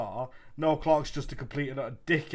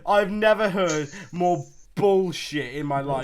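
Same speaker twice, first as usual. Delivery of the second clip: loud, high-pitched outburst of disbelief; indignant; 4 semitones higher, 7 dB louder than usual. Real, not acted. Friend calling out a bullshit excuse, indignant. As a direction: call out the excuse, drawing it out loudly.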